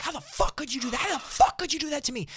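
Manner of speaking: imitated scream, very high-pitched; screaming driver. Canceled driver screaming at the rider over the phone, outraged. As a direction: mimic a screaming rant, high and strained. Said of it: "Just screaming at the top of his".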